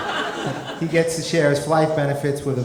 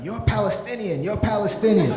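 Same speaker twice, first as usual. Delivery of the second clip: high-pitched mock screaming accusation; imitating a screaming old lady; 7 semitones higher than usual. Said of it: "she starts screaming at me".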